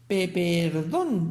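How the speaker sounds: slow, halting stammer. Acted. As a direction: trip over the first sound, flustered. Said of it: "balbuceó Hostos". (tartamudeando, muy despacio y nervioso)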